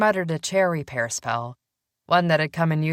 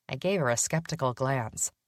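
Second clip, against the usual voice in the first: usual speaking voice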